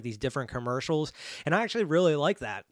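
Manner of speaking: usual speaking voice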